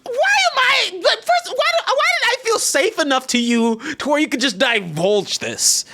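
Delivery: very high, disbelieving outcry; incredulous high exclaim. Real, not acted. Telling a friend the shock, high incredulous outcry. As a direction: squeal in disbelief.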